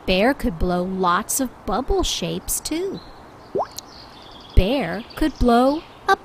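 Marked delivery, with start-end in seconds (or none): none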